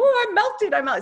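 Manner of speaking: high, loud wailing witch imitation; melting witch. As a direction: ham up the Wicked Witch melting away. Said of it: "the Wizard of Oz, when that witch melted, like, she went".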